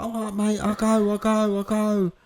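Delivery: high-pitched mock surrender; imitating a scared man giving in; placating panic. Frightened rivals backing down.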